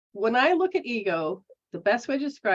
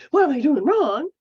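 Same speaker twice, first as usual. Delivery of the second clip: louder, higher, worried blurt; anxious self-blame; 5 semitones higher, 7 dB louder than usual. Retold or imitated. The wrong way to question yourself, loud fretful blurt. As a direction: fret out loud as if you're failing. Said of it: "It's not that".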